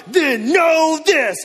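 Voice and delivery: wrestler shout. Retold or imitated. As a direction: bellow it at the Pharisees. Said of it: "Stone Cold Steve Austin on these fools".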